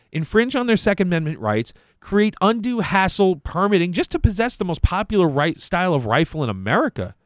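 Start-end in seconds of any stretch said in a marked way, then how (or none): none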